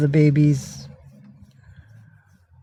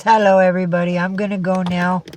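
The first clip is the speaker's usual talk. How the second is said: higher, perky cat announcement; lightly voiced cat, raised pitch; voicing the cat; 3 semitones higher than usual. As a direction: speak up proudly for your pet. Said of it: "He says".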